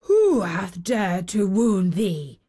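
put-on a touch of a grim old giant; angry. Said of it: "his face grew red with anger".

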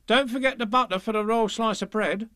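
high-voiced reminder; high put-on maid's voice; dairymaid. A narrator's reading. Dairymaid calls instructions to the cow.

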